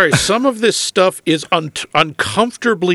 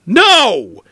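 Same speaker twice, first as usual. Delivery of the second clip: emphatic no; 7 semitones higher, 5 dB louder than usual. Real, not acted. Reacting to surprise ending.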